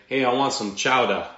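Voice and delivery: loud mock New England accent. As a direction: imitate a New Englander, loudly. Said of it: "somebody from New England say".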